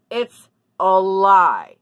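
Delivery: slow, loud, every word punched; emphatic. Real, not acted. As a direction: lean hard into lie, drawn out. Indignant about fake reviews.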